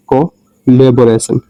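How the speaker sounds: Collaboration said slowly, syllable by syllable; slow demo pronunciation. Shown as a demonstration. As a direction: draw out collaboration slowly and clearly. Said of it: "इसको पढ़ेंगे". (Collaboration को धीरे-धीरे साफ़ बोलें)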